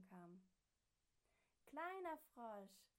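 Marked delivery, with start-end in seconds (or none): 1.7-2.9 slow, higher, much louder call. Acted. Frog family calling from the helicopter for the lost little frog. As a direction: call his name across the snow. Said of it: "riefen sie".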